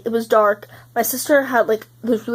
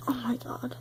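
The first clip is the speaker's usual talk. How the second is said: breathy whispered dread, barely audible; 3 semitones higher, 12 dB quieter than usual. Retold or imitated. Vlogger whispering her shock to viewers. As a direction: drop to a hushed, voiceless gasp of disbelief. Recalling the awful group chat breakup.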